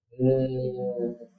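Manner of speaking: "Eh" held long on a low, even note; continuous sound. Shown as a demonstration. Teacher asking if students notice continuity, sustained sound. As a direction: sustain "Eh" steadily at low pitch.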